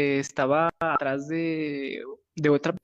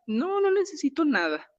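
put-on much higher girly voice; imitating the girl; 10 semitones higher than usual. Girl refusing help from the worried hosts, high imitated voice. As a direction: brush off the concern up high.

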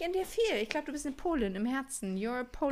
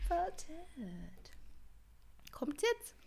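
voiceless whisper; 4 semitones higher, 10 dB quieter, much slower than usual. Real, not acted. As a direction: breathe the word. Echoing the clip in a whisper.

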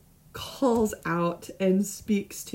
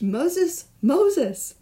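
high, raised call across a distance; 7 semitones higher, 4 dB louder than usual. Acted. God calling Moses from the bush, raised voice. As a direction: call his name from the burning bush.

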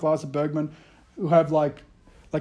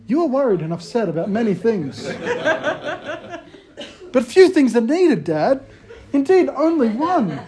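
mimicking son; 6 semitones higher than usual. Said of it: "I can just see him next week saying to me".

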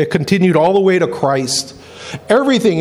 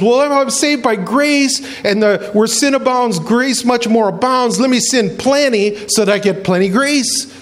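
higher-pitched, mock-naive reasoning; glib objector; 6 semitones higher than usual. Objector questioning salvation by grace, glib. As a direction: reason it out like a cheeky loophole-finder.

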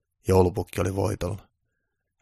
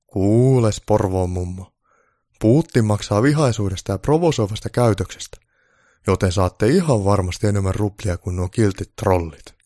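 lightly voiced Santa, louder; a hint of a persuasive Santa; Santa persuading; 8 dB louder than usual. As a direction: let Santa talk her round.

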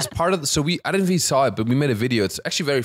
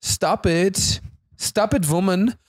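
higher-pitched German accent, playing serious; mock-stern German accent; 5 semitones higher than usual. German host proves Germans can be serious, mock-stern German accent.

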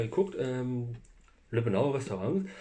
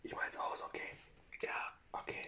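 whispering; 13 dB quieter, faster than usual. Real, not acted. Hushed ending of recording.

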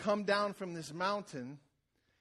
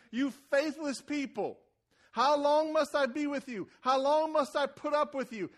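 loud, higher, exasperated Jesus rebuke; angry rebuke; 9 semitones higher, 8 dB louder than usual. Shown as a demonstration. Preacher voicing an upset Jesus to the congregation, loud angry rebuke. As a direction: let the frustration burst out hard. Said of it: "You can't say that nicely".

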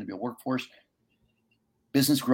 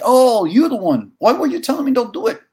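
retold astonishment; 14 semitones higher, 10 dB louder than usual. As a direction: caught off guard, reliving the moment. Realizing who the man was.

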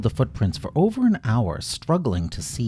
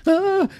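very high loud shriek of fright; startled scream; 7 dB louder than usual. Acted. Bramble hearing a stick snap, high frightened shriek. Stick snaps behind him.

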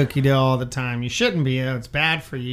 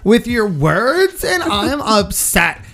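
put-on slow, higher entitled valley girl; 5 semitones higher, slower than usual. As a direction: demand the manager like a spoiled valley girl. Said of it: "Very valley girly".

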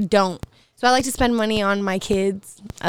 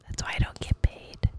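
whisper; 10 dB quieter than usual. Pretend. Joking whisper about stay-at-home pay.

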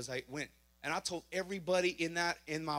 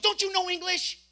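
much higher, louder scolding; exasperated cop; 12 semitones higher, 6 dB louder than usual. Retold or imitated. Officer scolding the driver, high loud exasperation. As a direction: snap it like a fed-up officer. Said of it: "She goes".

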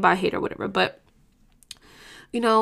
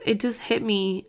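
ordinary talk, nothing marked

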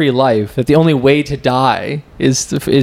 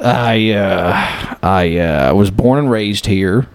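hammy salesman with a Texas twang; mock West Texas accent. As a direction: explain yourself in a put-on Texas accent. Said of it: "West Texas".

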